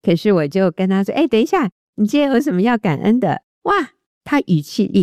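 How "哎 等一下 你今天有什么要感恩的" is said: higher-pitched, upbeat retold question; perky interjection. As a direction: cut in to redirect a sighing friend. Retells interrupting a sighing friend. (轻快地打断提醒)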